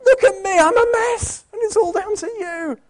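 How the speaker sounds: put-on high, loud slow tantrum of a toddler; blaming child. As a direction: whine the blame like a three-year-old. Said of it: "the child says".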